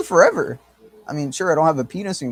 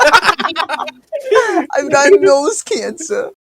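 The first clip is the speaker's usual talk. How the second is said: high, very slow put-on Yiddish accent; 13 semitones higher, 7 dB louder, much slower than usual. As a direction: whine it in a hammy Yiddish accent. Mock stereotype bit, high slow.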